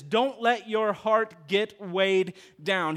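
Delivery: very slow, weighted warning; slow emphatic quote. Acted. Pastor quotes the verse slowly to the church. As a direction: stretch each word.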